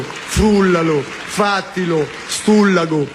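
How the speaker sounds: rattled-off Roman orders; mock Roman gangster; Roman accent. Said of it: "A uno del Roma".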